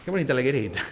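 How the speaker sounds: playful mock Galician tune; Galician accent. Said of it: "hablaban así porque eran gallegos".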